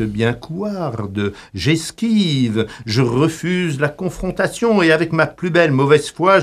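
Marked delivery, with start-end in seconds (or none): none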